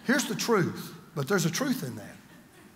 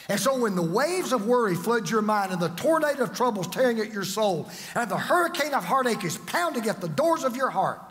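full-force preacher build, half sung over the organ; preaching over music; 4 semitones higher than usual. Acted. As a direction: swell into a sung, chanted climax. Preaching over music, rising intensity.

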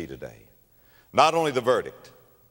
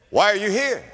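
lightly voiced king, raised high; questioning king; 10 semitones higher, 5 dB louder than usual. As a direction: put a hint of royal demand in the question. King questions a chained prisoner.

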